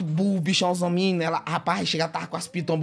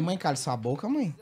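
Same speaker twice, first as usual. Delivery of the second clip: hushed scolding; 7 semitones lower, 7 dB quieter than usual. Retold or imitated.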